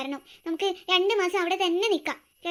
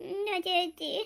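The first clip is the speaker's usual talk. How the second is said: put-on sleepy child; 10 dB quieter, much slower than usual.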